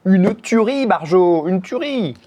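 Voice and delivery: high, loud burst of delight; ecstatic raving. Real, not acted. Vlogger raving over a homemade chocolate cake, ecstatic. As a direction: rave about it, voice leaping up.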